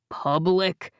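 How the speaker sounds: scornful, mocking echo; sneering. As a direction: sneer the word back. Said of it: "Jake sneered at me".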